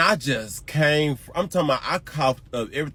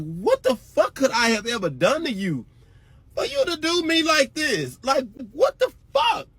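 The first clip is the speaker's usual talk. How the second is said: high, rising outraged yell climbing higher; hurt, enraged shriek at the ex; furious scream; 13 semitones higher than usual. Retold or imitated. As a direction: scream it at him, pitch climbing. Retold furious confrontation.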